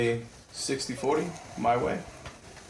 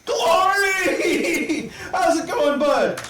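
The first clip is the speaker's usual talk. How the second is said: much higher and louder, cracking into laughs; zany high voice; 12 semitones higher, 4 dB louder than usual. Acted. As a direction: squeal it out like a giddy madman. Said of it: "And he's like".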